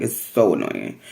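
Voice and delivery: ordinary talk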